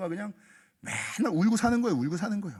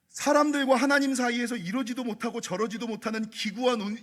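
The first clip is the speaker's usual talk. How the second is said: ordinary delivery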